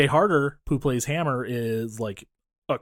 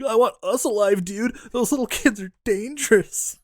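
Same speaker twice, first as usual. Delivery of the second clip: mock panicky henchman; 8 semitones higher than usual. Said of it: "And he's like".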